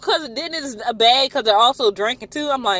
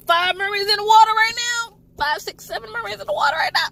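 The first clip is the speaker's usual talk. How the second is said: alarmed disbelief; 10 semitones higher than usual. Real, not acted. Alarmed count of mermaids.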